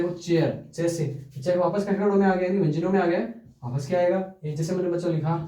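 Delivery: ordinary delivery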